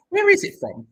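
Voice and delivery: very high excited question about the cheese. Real, not acted. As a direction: squeak it out in disbelief.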